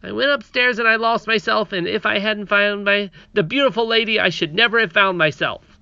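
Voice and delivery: put-on high, bright little girl voice; princess voice. Princess voiced, reading aloud.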